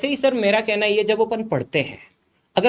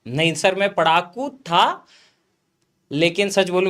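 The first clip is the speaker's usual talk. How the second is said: normal talking voice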